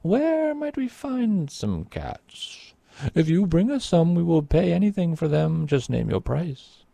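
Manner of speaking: desperate king offering anything; eager king. A narrator's reading. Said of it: "asked the king".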